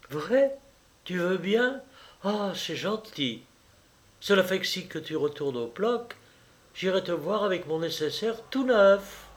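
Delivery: put-on high, pleased young woman's voice; delighted Juliette. A narrator's reading. Mistress thanking her lover for the money, high and delighted. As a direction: thank him sweetly.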